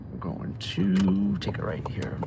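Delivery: soft whisper, slow. Real, not acted. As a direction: whisper it to yourself. Whispered driving note.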